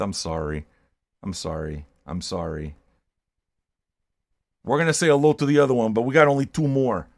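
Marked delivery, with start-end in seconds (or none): 0.0-2.7 much softer, lower sheepish sorry; half-hearted hushed apology; mock apology. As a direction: mumble it like a guilty kid. Mock apology for being a metalhead.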